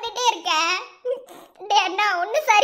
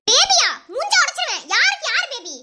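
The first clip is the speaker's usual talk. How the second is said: sudden high furious yell at the rival; angry outburst; 5 semitones higher than usual. Acted. Wife shouts at the woman calling her husband baby, furious. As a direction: snap back in outrage, threatening.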